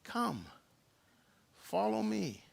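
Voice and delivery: soft but lifted, with pauses; gentle invitation. Acted. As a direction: beckon tenderly, one phrase at a time. Jesus gently calls Peter.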